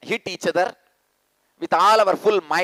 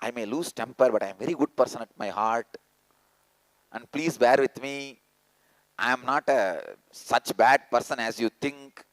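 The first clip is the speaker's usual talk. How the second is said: lower, softer, quick self-justifying murmur; mock excuse; 8 semitones lower, 4 dB quieter, faster than usual. Mocking the excuses listeners use in life, low quick murmur. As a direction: mimic a sheepish excuse. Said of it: "things you use in your life".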